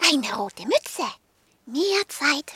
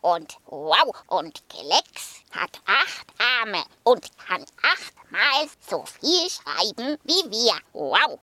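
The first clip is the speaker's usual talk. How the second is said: low woofing dog; 3 semitones lower than usual. Acted. Sokrates continues.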